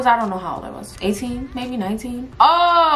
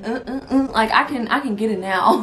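usual speaking voice

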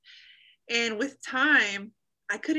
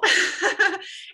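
short laugh; 10 semitones higher, 6 dB louder than usual. Real, not acted. Laugh before telling of salvation.

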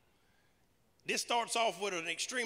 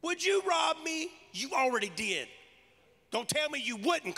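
loud accusing yell, higher on the question; 6 semitones higher, 4 dB louder than usual. Acted. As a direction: throw the answer right back at them. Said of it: "they yell the answer at you".

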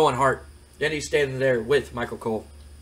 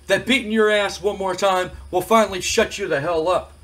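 lightly voiced cocky wrestling champion, pitched up; trash-talking wrestler; 6 semitones higher than usual. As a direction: talk down to your challenger, higher than usual. Acts out The Rock's trash-talk promo.

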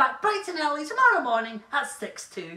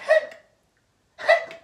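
squeaky high mock hiccup; fake hiccups; 13 semitones higher than usual. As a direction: pop out a playful hiccup. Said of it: "I've got the hiccups".

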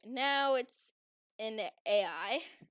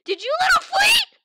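furious shout; 16 dB louder than usual. Acted. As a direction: scream it high and furious. Angry game roleplay outburst.

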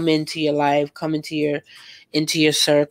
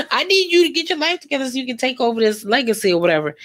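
higher, fast scolding; hammed-up nag at lazy kids; fast high mock scold; 7 semitones higher, faster than usual.

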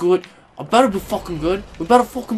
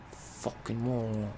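muttered insult; 8 semitones lower, 14 dB quieter, slower than usual. Real, not acted.

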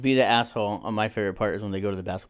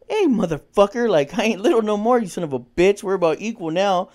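much higher and louder taunting shout; defiant yell; 9 semitones higher, 7 dB louder than usual. Retold or imitated. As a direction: square up to the old bully. Imagined confrontation with childhood bully.

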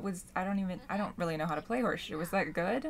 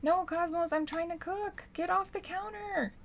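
scolding the cat; 7 semitones higher than usual. Real, not acted.

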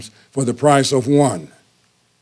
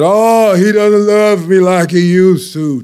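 high, louder, slow plaintive wail; whiny complaint; 7 semitones higher, 6 dB louder, slower than usual. Retold or imitated. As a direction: moan it to the elders, hurt. Wife complaining to elders.

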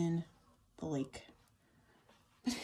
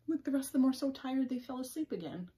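plain reading voice

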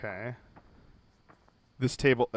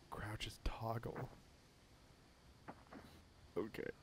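whispering; 3 semitones lower, 22 dB quieter than usual. Real, not acted. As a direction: whisper so he won't hear. Hiding from monster.

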